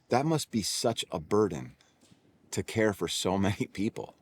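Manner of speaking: gently sympathise with his burden; tender sympathy. Retold or imitated. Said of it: "looks at me with the most tender eyes".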